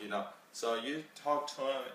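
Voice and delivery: usual speaking voice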